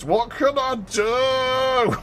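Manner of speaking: high, slow drawn-out whine; hammed-up child whine; whiny kid. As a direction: stretch it out like a pestering child. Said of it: "With that intonation".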